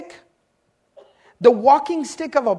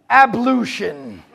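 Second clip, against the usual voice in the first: mock formal; 3 dB louder, much slower than usual. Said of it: "That's my favorite one".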